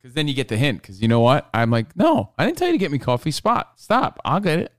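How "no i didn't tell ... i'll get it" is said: higher, fast mock protest; imitating herself fending off coffee. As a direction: rush out a high whiny refusal. Imagined protest against being babied.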